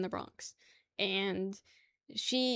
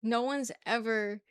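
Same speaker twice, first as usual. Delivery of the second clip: ordinary talk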